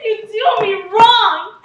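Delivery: loud, hurt protest; shouting at a mocking suitor; indignant shout. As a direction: fling the accusation back. Helena feels mocked.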